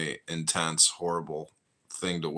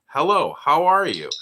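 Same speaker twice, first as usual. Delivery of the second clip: polite greeting; 8 semitones higher, 7 dB louder than usual. Shown as a demonstration. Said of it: "you are respectful and say".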